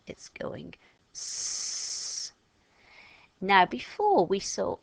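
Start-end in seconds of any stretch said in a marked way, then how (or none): 0.1-2.3 stretched, hissed s; sound s. Shown as a demonstration. Teacher showing the cedilla letter sound, slow hissed s. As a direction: draw out the s slowly. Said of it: "But with that mark underneath".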